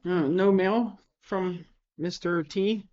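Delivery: ordinary talk, nothing marked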